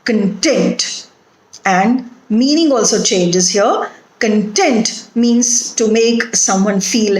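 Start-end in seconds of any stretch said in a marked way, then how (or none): 0.0-1.1 content said con-TENT; stress on second syllable. Shown as a demonstration. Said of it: "second syllable". 4.2-5.1 Content as con-TENT; stress on second syllable. Shown as a demonstration. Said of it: "second syllable".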